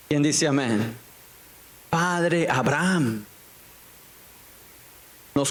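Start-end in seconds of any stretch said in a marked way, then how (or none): 1.9-3.3 llamado pausado y alargado, sin gritar; clamor imitado. Retold or imitated. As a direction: imita un clamor con palabras lentas. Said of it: "dando voces, dice". (clamor fingido, alargado)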